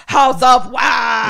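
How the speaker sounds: slow, loud, high horror-title cry; big title announcement. Acted. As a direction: proclaim it like giant movie-poster lettering. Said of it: "with, like, the big graphics of".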